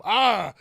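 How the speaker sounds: short loud yelp from the cartoon virus; a loud yelp as the virus is surprised. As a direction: let out a quick startled yelp. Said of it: "the co-, corona go".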